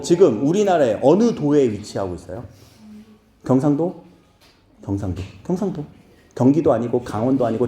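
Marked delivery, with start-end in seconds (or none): none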